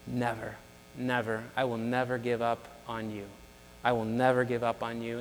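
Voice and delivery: low, soft promise; hushed and steady, voicing a solemn promise; tender reassurance. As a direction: reassure them quietly, pitch dropped. Voices God reassuring the listener.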